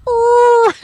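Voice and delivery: high, loud cartoon growl; mock wolf roar. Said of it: "it just goes".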